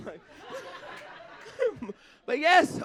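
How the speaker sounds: ordinary talk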